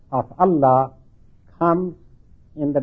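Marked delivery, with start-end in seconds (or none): none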